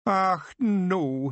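high mock Scottish wail; a pictsie dismayed at being caught; hammed-up Scots wail. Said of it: "he wailed".

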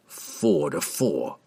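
low, slow menacing growl. A narrator's reading. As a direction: drag it out low like a threat. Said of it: "the assassin growled".

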